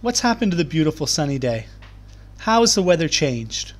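normal talking voice

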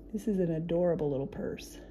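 normal talking voice

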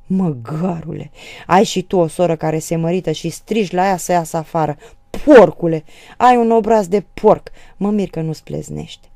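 loud, outraged tirade; angry insults. A narrator's reading. Sister cursing her brother for his insults, angry. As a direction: hurl each insult at him.

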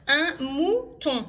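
slow, loud, drawn-out dictation word; dictating slowly. Shown as a demonstration. Mother dictating a word to her daughters, slow and loud. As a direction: spell it out slowly for the dictée.

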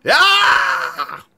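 high, loud mock meltdown scream; frustrated scream. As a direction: scream as the character loses it. John melts down in skit.

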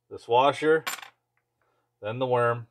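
ordinary talk

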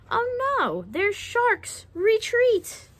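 high-pitched storybook panic; mock alarm. Reader voicing trap-makers who spot sharks, mock alarm. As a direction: call a retreat in playful fright.

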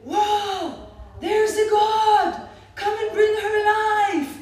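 a high, loud, laughing shout of joy; ecstatic scream. Retold or imitated. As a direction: yell it as if a miracle were happening. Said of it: "she started screaming".

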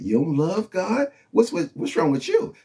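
high, mock-baffled question; sing-song disbelief at the sinner; incredulous challenge. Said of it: "she said".

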